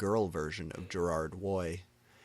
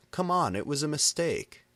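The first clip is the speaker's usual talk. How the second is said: pleading defense; 8 semitones higher, 4 dB louder than usual. A narrator's reading. Defending Lucian.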